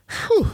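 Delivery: long high whew; relieved exhale. Real, not acted. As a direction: blow out the breath. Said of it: "I'm a little winded".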